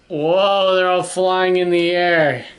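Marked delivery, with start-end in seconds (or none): none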